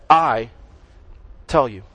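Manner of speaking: "I" hit firmly, then a pause before "tell you"; emphatic, isolated "I"; stress on I. Retold or imitated. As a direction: punch "I" on its own, drop down on "tell you". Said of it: "it's emphasized".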